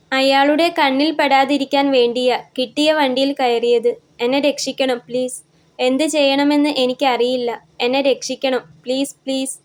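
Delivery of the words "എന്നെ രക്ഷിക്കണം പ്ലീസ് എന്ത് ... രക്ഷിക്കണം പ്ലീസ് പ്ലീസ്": usual speaking voice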